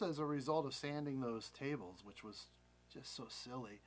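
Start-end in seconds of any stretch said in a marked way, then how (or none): none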